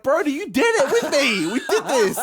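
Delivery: high, loud, half-laughing yell; comically betrayed outcry, voice shooting up; outraged accusation. Retold or imitated. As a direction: retell it as a disbelieving shout at your sister. Retold accusation of lying sister.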